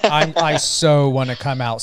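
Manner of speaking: ordinary talk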